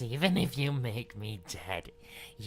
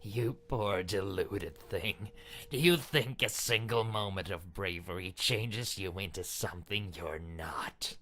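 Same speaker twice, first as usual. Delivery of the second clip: usual speaking voice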